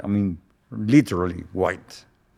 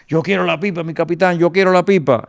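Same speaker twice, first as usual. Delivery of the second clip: high, pleading imitation of an eager sergeant; acted-out excited demand in Spanish; insistent demand; 8 semitones higher, 4 dB louder than usual. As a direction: blurt it out, high-pitched and pressing. Acting out sergeant bursting in demanding.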